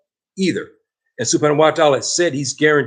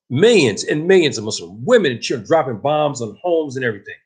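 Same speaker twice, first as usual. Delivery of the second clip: usual speaking voice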